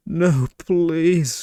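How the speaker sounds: high, pleading gasp of horror; horrified plea. Acted. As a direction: beg him to stop, aghast. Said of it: "Her eyes widened in horror".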